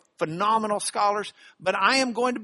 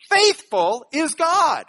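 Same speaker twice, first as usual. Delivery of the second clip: "faithful" hit high and loud, slowly; stress on faithful; 9 semitones higher, 9 dB louder, slower than usual. Shown as a demonstration. Preacher shows Paul's emphasis to the church, emphatic stress on "faithful". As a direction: punch "faithful" as the key word. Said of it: "Paul's emphasis here is".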